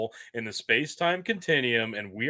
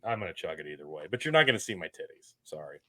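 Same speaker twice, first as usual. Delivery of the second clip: ordinary talk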